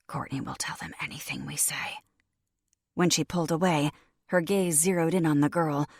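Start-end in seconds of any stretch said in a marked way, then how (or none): 0.1-2.0 soft unvoiced whisper in the ear. A narrator's reading. Lyra warning Sydney during a hug, secret whisper. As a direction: breathe it secretly into her ear. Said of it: "whispering into my ear".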